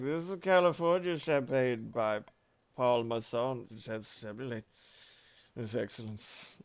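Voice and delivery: slurred pitchman trailing into a soft mumble; mock-tipsy ad read, fading away; drunk Orson Welles. Streamer re-enacts the California champagne ad, drunk slurred impression. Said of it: "He's like".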